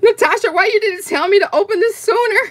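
high, quick protest; excited mock scolding. Real, not acted. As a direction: scold her in delighted, high-pitched disbelief. Mock scolding over surprise gift.

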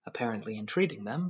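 ordinary delivery, nothing marked

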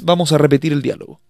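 ordinary delivery

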